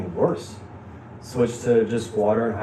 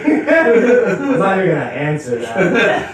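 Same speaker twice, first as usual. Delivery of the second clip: laughing, slow mock reply; 7 semitones higher, 5 dB louder, slower than usual. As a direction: giggle through the quote. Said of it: "and he goes".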